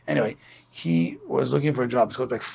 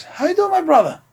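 jolly stranger; 6 dB louder than usual. Retold or imitated. Jolly passenger greets dejected man.

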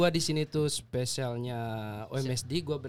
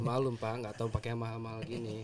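distant from the microphone; far from mic; 6 semitones lower, 8 dB quieter than usual. Real, not acted. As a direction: voice too faint off mic. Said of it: "suaranya kurang".

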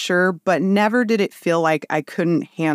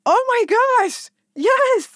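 very high thrilled squeal; excited yes. Retold or imitated. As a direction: gush with delight, way up. Excited reply to nurses.

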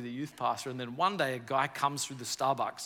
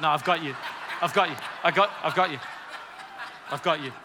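loud, eager "I've got you"; mock generous friend insisting, louder; 3 semitones higher, 4 dB louder, faster than usual. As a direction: grab the bill before anyone else can. Mimics generous friend insisting on paying.

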